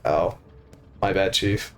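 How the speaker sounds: dropped low, sheepish my bad; embarrassed apology sunk deep in the voice.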